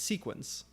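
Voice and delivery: "sequence" pronounced SEEK-wence; "sequence" with a long ee. Shown as a demonstration. Streamer explaining the name to viewers, "sequence" with a long ee. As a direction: say "sequence" with the first vowel as in "seek". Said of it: "the reason I pronounce it seek".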